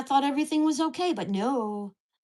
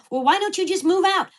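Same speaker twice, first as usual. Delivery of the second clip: high, loud mocking challenge; mock a quarrelsome neighbor; imitated taunting neighbor; 7 semitones higher, 5 dB louder than usual. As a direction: throw the taunt out, pitched well up. Acts neighbor's taunt in retold quarrel.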